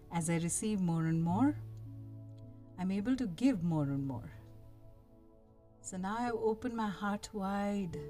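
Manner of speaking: calm guiding voice, unhurried; soft spa whisper. Acted. Leads a guided meditation.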